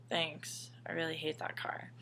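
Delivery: soft, unvoiced deadpan reply; a whispered fake thanks. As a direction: mutter it flatly under your breath. Retold mock-grateful reply.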